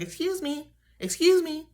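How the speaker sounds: mock-prim high excuse me, said twice; friend's high excuse me. Best friend calls out to the mom, in a high mock-prim voice. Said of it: "she was like".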